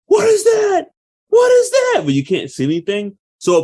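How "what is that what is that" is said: high, loud imitation of a frightened runner; mock panicked yell. Horror heroine running from something unseen, mock panicked yell. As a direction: act out her panicked scream, pitched way up. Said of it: "they're running and she's like".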